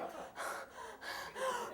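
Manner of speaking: long soft unvoiced sigh of exasperation; exasperated sigh. Hearing the mother ask another favor, a long exasperated sigh. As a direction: let out a long tired sigh.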